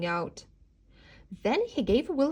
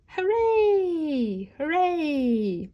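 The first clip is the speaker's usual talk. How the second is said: cheering; 6 semitones higher, much slower than usual. A narrator's reading. Wolf celebrating passing Octavia, cheering. Wolf's triumph.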